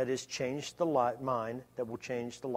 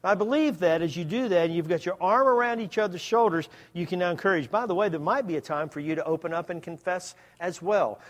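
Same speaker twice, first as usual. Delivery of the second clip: full-force preaching; 3 semitones higher, 5 dB louder than usual. Acted. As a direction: preach it hard to the congregation.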